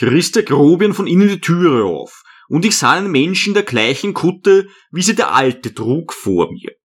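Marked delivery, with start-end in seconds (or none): none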